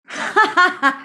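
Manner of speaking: high, loud laugh; burst of laughter. Real, not acted. Laughing at the couple's skit, loud laugh.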